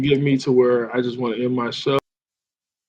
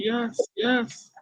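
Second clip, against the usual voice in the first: put-on high, soft girlish yes; girlfriend voice; 9 semitones higher, 8 dB quieter than usual. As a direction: play the swooning girlfriend. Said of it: "She was like".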